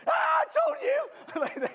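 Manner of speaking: very high, drawn-out gotcha yell; triumphant mock shout. Suspicious visitor spotting the Kool-Aid, mock triumphant shout. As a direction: shout it as hammed-up vindication. Acts out imagined gotcha reaction.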